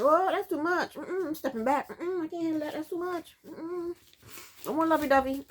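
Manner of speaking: high-pitched sassy mm-mm backing off; playful put-on pettiness; petty refusal. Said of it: "I'm petty like that".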